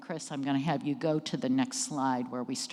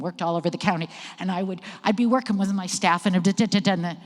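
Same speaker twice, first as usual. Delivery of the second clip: fast, higher, louder, busy rattle; stressed and rushed; 3 semitones higher, 6 dB louder, much faster than usual. Shown as a demonstration. Director recalling busy days with staff, stressed and rushed. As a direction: rattle it off under work stress. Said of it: "went up and I talked too fast".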